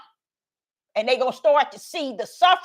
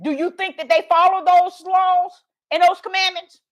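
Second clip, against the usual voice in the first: normal talking voice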